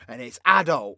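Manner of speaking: ordinary delivery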